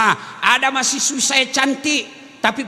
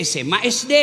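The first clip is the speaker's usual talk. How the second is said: normal talking voice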